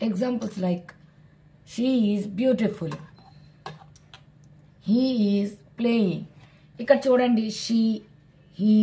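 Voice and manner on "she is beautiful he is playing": very slow, deliberate example reading; slow model sentences. Shown as a demonstration. Teacher reading examples slowly to children. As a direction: spell each word out for beginners.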